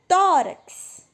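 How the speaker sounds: Tórax with TÓ loud, high and long; stress on first syllable. Shown as a demonstration. Teacher showing which syllable is strongest, stressed Tórax. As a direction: belt the tó of Tórax, drop on rax. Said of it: "Então, o tó é a mais forte".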